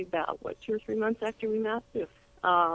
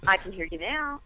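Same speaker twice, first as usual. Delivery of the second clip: on speakerphone. Real, not acted. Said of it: "it sounds like you're on speakerphone".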